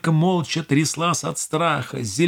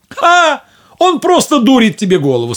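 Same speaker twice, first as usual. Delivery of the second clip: high, loud scoffing mother voice; scornful mother; 10 semitones higher, 8 dB louder than usual. Acted. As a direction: scoff at her daughter's naivety.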